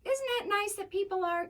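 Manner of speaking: very high mimicked voice; put-on cooing questioner. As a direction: pitch it way up, mock-sweet. Mimics a voice, then answers it.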